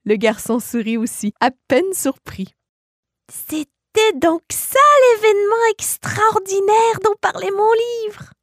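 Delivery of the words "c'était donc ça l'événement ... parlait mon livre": put-on child's voice. Boy realizing what his book meant, in a high child voice. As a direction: think it aloud like a wondering child.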